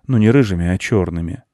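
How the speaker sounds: plain reading voice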